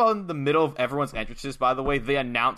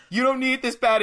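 high, raised-voice mock objection; mock protest; 10 semitones higher, 4 dB louder than usual. Gene argues with Suge over the money, mock protest. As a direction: argue back with the pitch pushed up. Acted argument between wrestlers.

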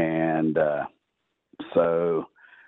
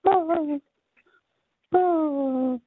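fading far-off cry. Retold or imitated. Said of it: "it just gets fainter and fainter".

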